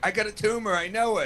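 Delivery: a light take on a neurotic Woody Allen type, sure he's dying; quick mock panic of a nervous hypochondriac. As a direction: panicky high whine of a worrier. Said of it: "You either get Woody Allen".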